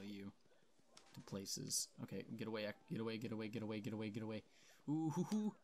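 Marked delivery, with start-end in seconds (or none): 4.9-5.5 high, loud mocking hoo-hoo; taunting hoot. Real, not acted. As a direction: crow at them from safety. Taunting enemies from a ledge.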